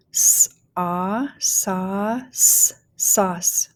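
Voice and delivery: "sauce" built up sound by sound; teacher blending "sauce" with gaps between sounds; phonics blending. Shown as a demonstration. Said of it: "go ahead and start blending".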